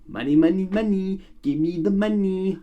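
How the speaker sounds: high, grabby mock voice; greedy executive voice. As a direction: play a money-hungry exec. Mocking greedy studio executives.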